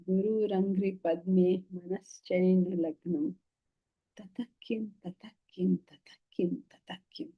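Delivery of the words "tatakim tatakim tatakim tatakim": whispered Sanskrit refrain; hushed refrain. Acted. Teacher reciting the verse's refrain to the class, hushed. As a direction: repeat it under the breath, barely voiced.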